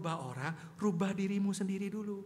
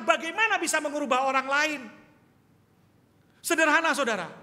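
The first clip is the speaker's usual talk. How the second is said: loud, raised preaching voice; full-force preaching; 8 semitones higher, 12 dB louder than usual. Acted. Pastor exhorting the congregation, loud and high. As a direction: pound it out from the pulpit.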